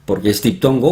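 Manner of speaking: usual speaking voice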